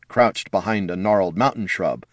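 usual speaking voice

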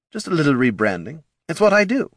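overeager praise, pitched high; high, chirpy marketing exec. Acted. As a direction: gush like a cheerful salesman. Marketing head.

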